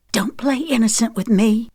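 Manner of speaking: high, angry disgust; hissing with anger. A narrator's reading. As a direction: spit the words with contempt. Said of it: "she hissed".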